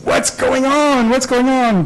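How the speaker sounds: high, slow, worried "what's going on"; alarmed wife. Retold or imitated. Wife woken at dawn by the phone, alarmed and high.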